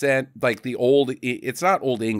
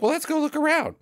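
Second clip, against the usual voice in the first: high put-on lady voice; imitated woman, much higher pitched; woman's voice; faster than usual. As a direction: greet him in a high feminine lilt. Voicing the woman's reply.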